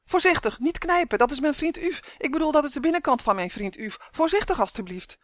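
high, urgent alarmed cry; yelled plea. A narrator's reading. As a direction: cry out high, pleading for care. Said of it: "en gilde".

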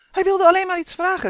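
high, loud, hurried protest; urgent high-pitched call. A narrator's reading. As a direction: shout it up quickly in someone's defence. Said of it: "riep Justin".

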